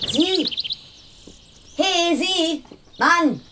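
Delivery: calling out. Acted. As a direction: call him back before he's gone.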